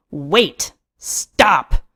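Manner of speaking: urgent cry to halt him; shout. A narrator's reading. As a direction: cut in forcefully, two separate bursts. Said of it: "I shout".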